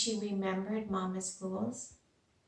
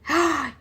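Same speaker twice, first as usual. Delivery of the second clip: gasp; 6 semitones higher, 9 dB louder than usual. Acted. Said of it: "gasped another".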